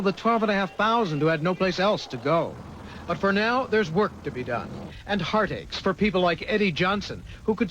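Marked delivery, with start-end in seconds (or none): none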